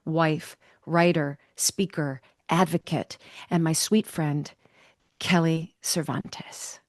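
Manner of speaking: measured, scripted introduction read; podcast introduction. Acted. Host introducing her author friend to listeners, measured introduction. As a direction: read it as a carefully phrased tribute.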